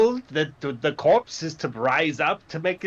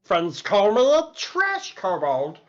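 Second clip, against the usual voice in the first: lightly pitched-up small creature voice; kobold voice; 4 semitones higher than usual. Acted. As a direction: keep the little kobold voice going. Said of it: "a kobold takes its stance".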